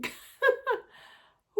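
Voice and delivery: a high, shaky ooh as tears rise; emotional ooh. Real, not acted. Vlogger catching herself tearing up on camera, emotional ooh. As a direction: let the feeling break through in a high ooh. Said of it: "I told y'all I was emotional today".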